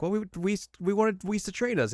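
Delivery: high-pitched, sheepish explanation. Retold or imitated. Goku answering Beerus, sheepish and high. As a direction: squeak it out nervously.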